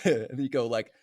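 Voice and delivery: normal talking voice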